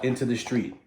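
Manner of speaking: ordinary delivery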